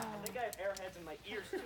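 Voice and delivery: ordinary delivery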